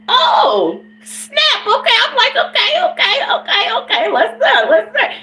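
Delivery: high, excited okay okay, let's do it; hyped reaction. Retold or imitated. Storyteller hyping herself up for the party. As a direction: pump yourself up, laughing.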